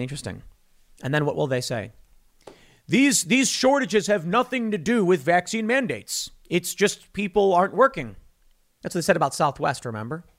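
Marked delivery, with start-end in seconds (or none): 2.9-8.2 higher mock-earnest denial; mocking official excuse. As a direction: parrot their excuse mockingly, pitched up.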